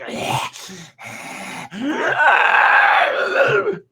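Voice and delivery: hissing breaths into a loud, high wail; monster noise. Acted. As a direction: act out the creature's snarling howl. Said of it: "Baba Yu kinda like".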